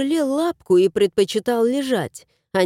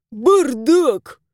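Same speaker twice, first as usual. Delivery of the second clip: disgusted verdict; 2 semitones higher, 3 dB louder than usual. A narrator's reading. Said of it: "резюмировал домовой".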